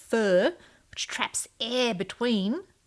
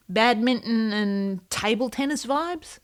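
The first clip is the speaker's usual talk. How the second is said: usual speaking voice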